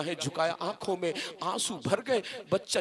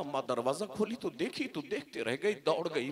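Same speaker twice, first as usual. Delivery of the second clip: usual speaking voice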